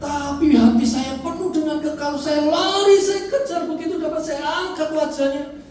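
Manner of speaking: high, loud, impassioned burst; urgent retelling. Retold or imitated. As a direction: relive the dash to your child. Climax of retold rescue of son. (bercerita menggebu, lantang)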